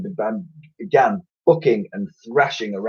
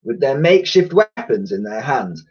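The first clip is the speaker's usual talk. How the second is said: ordinary delivery, nothing marked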